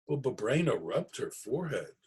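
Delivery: plain reading voice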